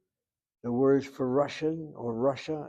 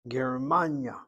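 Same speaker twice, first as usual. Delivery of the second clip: "Germania" with MAN stressed and falling away on ya; second-syllable stress. Shown as a demonstration. Teacher repeating the model, "Germania" stressed on MAN. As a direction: hit the middle of "Germania". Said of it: "Hit the second of the three syllables".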